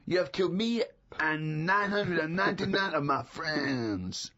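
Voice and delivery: put-on slow, comic French accent frog. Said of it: "And they go".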